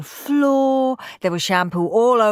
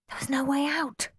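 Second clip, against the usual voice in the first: whispered; 8 semitones higher, 7 dB quieter than usual. A narrator's reading. Henry's despair.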